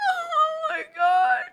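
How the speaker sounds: high, gasping mock sob over a compliment; mock crying ex. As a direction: ham up a blubbering boyfriend, squeaky and teary. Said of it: "He would cry for everything".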